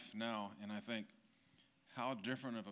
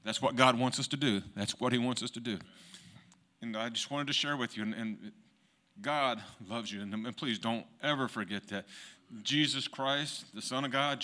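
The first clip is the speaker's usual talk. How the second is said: preaching at full force; 8 dB louder than usual. Real, not acted. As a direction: preach it full voice, lifting the room.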